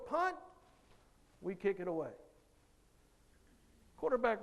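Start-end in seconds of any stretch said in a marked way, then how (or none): 0.1-0.4 coach yelling. Retold or imitated. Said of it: "Coach yells".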